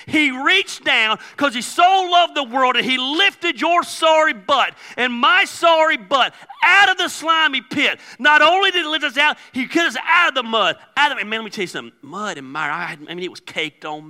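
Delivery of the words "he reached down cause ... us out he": rising preacher shout. Real, not acted. Pastor shouting to congregation about being lifted out. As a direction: preach it hard and higher, let it ring.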